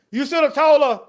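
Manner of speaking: ordinary talk, nothing marked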